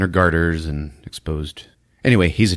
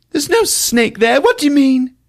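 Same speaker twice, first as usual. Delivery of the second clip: prim English lady; 4 dB louder than usual. Retold or imitated. Eve telling Hugh what she saw, hammed-up high prim lady. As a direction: play a flustered posh lady. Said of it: "Eve's voice".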